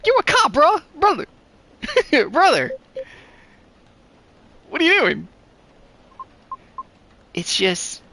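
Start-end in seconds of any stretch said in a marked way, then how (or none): 0.1-2.7 high, loud mocking challenge; teasing disbelief; incredulous jab. Mock accusing the character. 4.7-5.3 incredulous question. Acted. Incredulous reaction.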